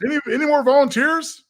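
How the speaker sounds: high, loud taunting call; mock villain. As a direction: call for victims with glee. Jokes after lackeys vaporized.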